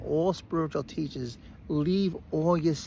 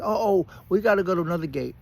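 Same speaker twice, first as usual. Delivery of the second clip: louder, higher mock outburst; mock exasperated; 4 semitones higher, 6 dB louder, faster than usual. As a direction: ham up the gate change news. Retold repeated gate changes.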